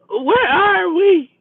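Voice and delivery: put-on high, slow, groggy drunk voice; a drunk waking up confused; mock drunk, high and dragged out; drunk woman waking.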